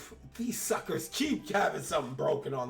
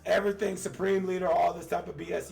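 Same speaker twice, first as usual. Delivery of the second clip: excited; 8 semitones higher, 7 dB louder than usual. Real, not acted. Hyped streamer rant about plot.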